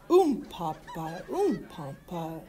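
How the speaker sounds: slow oompah chant; slow sung band noise; brass band oompah. A narrator's reading. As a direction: mimic a tuba slowly.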